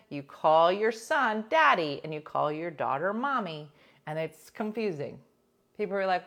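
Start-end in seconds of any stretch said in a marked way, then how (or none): none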